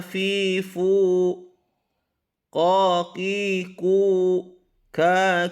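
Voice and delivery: high, chanted syllables like Quran reading; a teacher modelling tajwid recitation; Quran recitation drill. Shown as a demonstration. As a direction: recite each syllable with the vowels ringing. Teacher chants Arabic letter drills.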